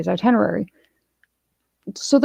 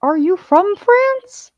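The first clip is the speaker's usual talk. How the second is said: much higher, slow, astonished; mock surprised question; 13 semitones higher, slower than usual. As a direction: play the coworkers gasping in surprise. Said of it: "they're like".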